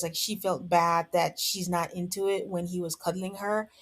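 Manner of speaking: ordinary talk, nothing marked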